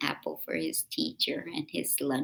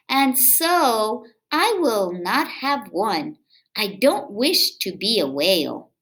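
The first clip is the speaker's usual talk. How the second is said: put-on lively read-aloud for kids; storybook reading; 6 semitones higher, 11 dB louder, slower than usual. A narrator's reading. Reading a picture book aloud to children, lively.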